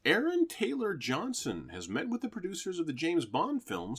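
bright announcer voice reading headlines; news anchor read. Acted.

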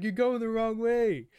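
high, loud, slowed yell after him; mock yell. Mascot yelling at the fleeing girls, high slow mock yell. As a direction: shout it slowly as he runs off. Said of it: "He's like".

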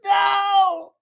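loud high dramatic no; dramatic yelling. Real, not acted. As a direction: shout it over and over in panic. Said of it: "That was a little dramatic".

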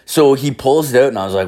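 usual speaking voice

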